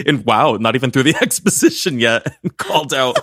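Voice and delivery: real laughter breaking through the words; laughing. Real, not acted. Cracking up at being corrected.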